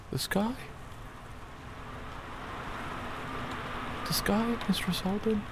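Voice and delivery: high-pitched, halting astonishment; struggling to take in an impossible sight; stunned disbelief. Acted. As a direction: falter upward in shock, pausing between phrases. Stunned at seeing the burning sky.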